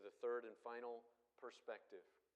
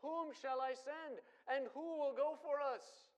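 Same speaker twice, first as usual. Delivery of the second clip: higher, louder, slow; ringing call; 11 semitones higher, 7 dB louder than usual. Acted. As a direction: cry out God's question to the room. Preacher cries God's call.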